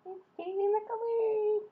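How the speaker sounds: high, delighted squeak at the colors; bubbling over with delight; excited squeal. Real, not acted. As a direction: squeal it out, thrilled. Thrilled by glowing light stick.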